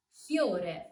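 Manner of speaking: fiore with I glided into O; correct fiore, Y glide. Shown as a demonstration. Teacher showing learners correct fiore with Y glide. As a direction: say fiore with I as a quick Y. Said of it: "but more like a Y".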